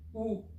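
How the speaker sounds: "ou" said alone as one vowel; vowel sound demo. Shown as a demonstration. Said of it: "nous donne". (« ou » isolé, prononcé en un seul son)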